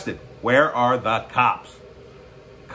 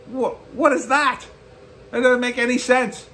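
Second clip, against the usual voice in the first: incredulous; 11 semitones higher than usual. Retold or imitated. Mocking diners harassed by protesters.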